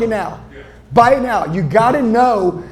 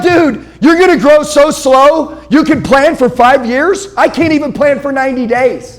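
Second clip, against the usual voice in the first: incredulous shout; 6 semitones higher, 6 dB louder than usual. Retold or imitated. Speaker mocking people's five-year plans, loud incredulous shout. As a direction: blast it back at the five-year planners. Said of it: "I'm like".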